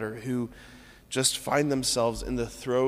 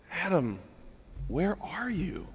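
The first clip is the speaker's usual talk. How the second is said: tender searching; 5 semitones higher, 5 dB quieter than usual. Shown as a demonstration. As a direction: call gently, like a worried parent. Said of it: "So different".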